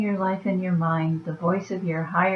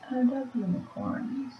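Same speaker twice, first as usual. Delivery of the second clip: high and hushed, swooning; high soft dreamy voice; 6 semitones higher, 9 dB quieter than usual. Shown as a demonstration. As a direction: coo it like a starry-eyed girl. Repeats line in a new voice.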